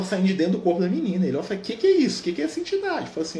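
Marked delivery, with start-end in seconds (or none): 1.6-3.0 high-pitched astonished questions; retold amazement. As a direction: mimic stunned disbelief, pitch leaping far up. Divaldo amazed by a vision.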